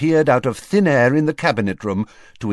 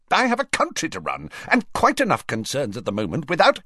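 quick, higher, impatient; irritated Prime Minister; 7 semitones higher, faster than usual. Acted. Prime Minister brushing off Fudge in quick irritation. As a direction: rattle it off to get rid of him.

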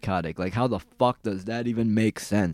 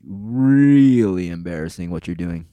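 really stretched slow and louder; slow, louder scolding emphasis; drawn-out emphasis; 4 semitones lower, 7 dB louder, slower than usual. Real, not acted. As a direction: lean hard on the really, slowly. Ranting at meme reposter.

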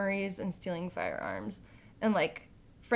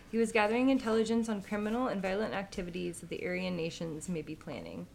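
ordinary delivery

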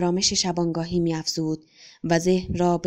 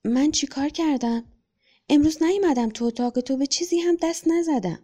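put-on higher bewildered little boy's voice; innocently baffled, pitched up; bewildered child; 7 semitones higher than usual. A narrator's reading. Son asks his father what he did wrong, bewildered and higher. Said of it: "هاج و واج موجه زد و پرسید".

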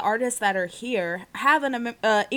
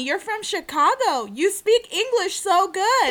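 mock interviewer; 7 semitones higher than usual. Mock question to Johnny.

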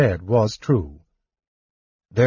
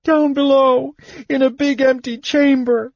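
much higher, louder blubbering; blubbering kender; 3 dB louder than usual. A narrator's reading. Kender blubbers his confession to the companions, high and tearful. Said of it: "Tas blubbered".

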